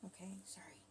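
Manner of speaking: hushed, whispered aside; barely voiced aside; whispered apology. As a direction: whisper a quick sorry mid-prayer. Hushed aside during prayer.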